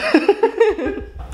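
a bright high giggle breaking out; high laugh. Real, not acted. Vlogger laughing at her funny numb speech, giggling.

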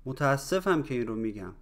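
quick, run together in one breath; natural conversational speed. Shown as a demonstration. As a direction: say it fast like everyday talk. Same phrase at natural speed. (سریع و روان، با سرعت محاوره)

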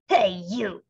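grouchy ladybug. A narrator's reading. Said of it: "said the grouchy ladybug".

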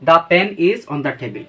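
"the pen is on the table" read slowly, word by word; teacher giving the example slowly. Shown as a demonstration. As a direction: dictate the sentence clearly. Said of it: "যেমন".

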